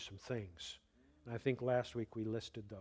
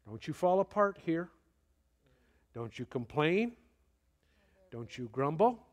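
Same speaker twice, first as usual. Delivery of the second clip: stern self-talk; 3 semitones higher, 5 dB louder than usual. Shown as a demonstration. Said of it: "make sure I gather myself together".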